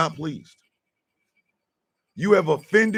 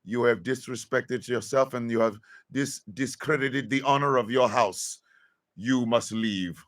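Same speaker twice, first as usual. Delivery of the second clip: a lightly solemn mock royal decree, lower and quieter; a half-mocking formal banishment speech; formal ejection decree; 7 semitones lower, 5 dB quieter than usual. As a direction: pronounce the sentence like a court herald, gravely. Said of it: "we should say something like".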